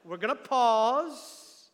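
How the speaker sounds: slow down and hang on the word "pause"; drawn-out pause. Shown as a demonstration. Preacher showing what a colon does, drawn out. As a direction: PAUSE stretched out long. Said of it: "We're gonna stop for a minute".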